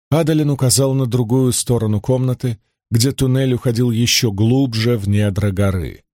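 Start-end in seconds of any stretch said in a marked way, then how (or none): none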